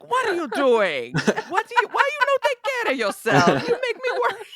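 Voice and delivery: high, loud Italian grandma scolding; hammed-up Italian nonna fretting; Italian nonna accent. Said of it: "Complete with bad Italian accent".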